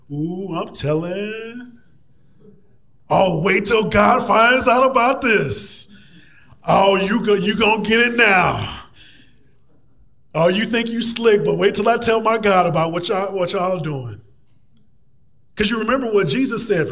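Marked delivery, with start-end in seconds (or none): 0.1-1.8 slow, rising singsong tattle; tattletale. Acted. Preacher voicing Nehemiah's prayer as a threat to tell, singsong tattling. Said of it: "kinda here". 3.1-14.2 loud, hammed-up tattling taunt; tattletale threat. Preacher warning the haters God will find out, loud mock tattling. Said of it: "As I read this, I'm kinda here".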